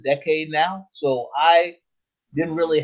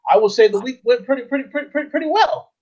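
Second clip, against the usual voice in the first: rising pitch; 9 semitones higher, 3 dB louder than usual. Acted. Building "pretty" repetition.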